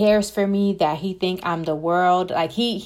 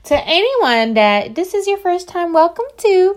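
much higher and louder, beaming; bright excited welcome; 10 semitones higher, 6 dB louder than usual. Real, not acted. Host greeting new listeners, excitedly higher and louder. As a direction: greet the newcomers with a big lift.

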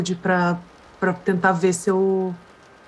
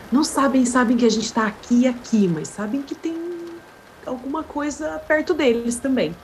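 ordinary delivery

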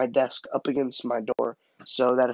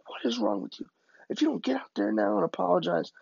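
high, strained reprimand without volume; scolding dad; 8 semitones higher than usual. Retold or imitated. Dad holding son by the collar, high strained scolding. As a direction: imitate an exasperated father's threat.

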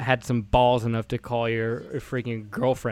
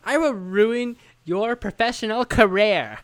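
mock threat; 8 semitones higher, slower than usual. Mocking friend's threat.

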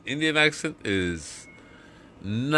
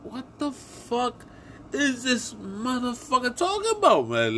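high-pitched incredulous listener; mock bewilderment, pitched way up; baffled listener; 13 semitones higher than usual. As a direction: put on a high voice of disbelief. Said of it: "They're gonna be like".

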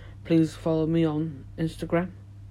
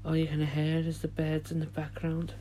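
soothing guided relaxation, fading quieter; guided meditation; 9 dB quieter than usual. Acted. Leads a meditation.

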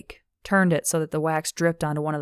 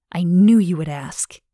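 conspiratorial; 7 dB louder than usual. Acted. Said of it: "smiled conspiratorially".

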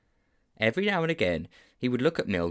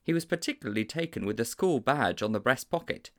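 usual speaking voice

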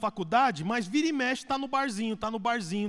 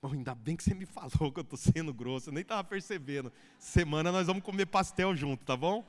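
softer, lower mock-sweet thanks; mock grateful reply; 7 semitones lower, 6 dB quieter than usual. Husband imagined thanking wife for criticism, mock-sweet. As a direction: play the husband's too-nice reaction. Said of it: "como é que o marido recebe?".

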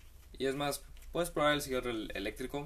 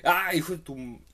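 loud, high outburst of cursing; hammed-up yell; mock shout; 6 semitones higher, 9 dB louder than usual. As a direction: blurt it out like a fan losing it at a match. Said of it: "y gritar".

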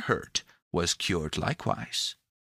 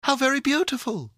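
high, quick, louder cry of admiration; exclaiming; 5 dB louder, faster than usual. A narrator's reading. Pandora admires the rainbow wings, exclaiming. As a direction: burst out admiringly, high and fast. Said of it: "exclaimed Pandora".

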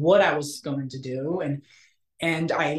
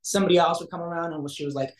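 ordinary delivery

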